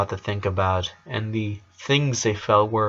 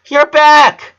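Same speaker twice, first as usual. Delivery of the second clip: much higher, louder squeal of joy; excited little girls; 10 dB louder than usual. Acted. Twins greet their returning brother, high excited cry. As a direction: shout it like thrilled kids. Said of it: "said the twins in unison".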